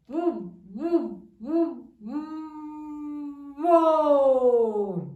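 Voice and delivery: syllable-building chant of "bu" into "bo"; sounding out. Shown as a demonstration. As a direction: pulse "bu, bu, bu" and land on "bo".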